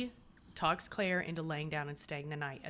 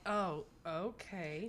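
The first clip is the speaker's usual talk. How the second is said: very slow, drawn-out reply; hesitant acceptance; 5 dB quieter, much slower than usual. Acted. Jamie hearing Claire stayed at hospital, slowly hesitant. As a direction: stretch it out doubtfully.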